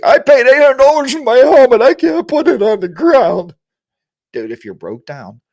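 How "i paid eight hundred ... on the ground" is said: high, loud mock whine; mock an indignant helmet owner; exaggerated high-pitched protest; complaining biker. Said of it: "I'm gonna get guys like".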